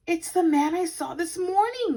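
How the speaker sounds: plain reading voice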